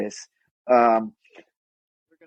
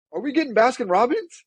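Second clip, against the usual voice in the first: squeaky little Ant-Man voice; 11 semitones higher than usual. Retold or imitated. Quoting a tiny Ant-Man.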